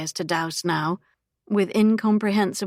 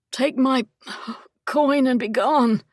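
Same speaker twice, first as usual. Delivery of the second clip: strained grunt; 5 semitones higher than usual. Acted. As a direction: grunt it out while being beaten. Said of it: "he grunted".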